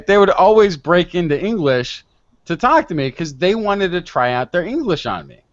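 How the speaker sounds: ordinary talk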